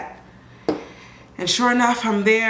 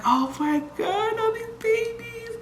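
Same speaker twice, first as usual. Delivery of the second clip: cooing at babies; 4 dB quieter, slower than usual. Retold or imitated. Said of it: "I was like".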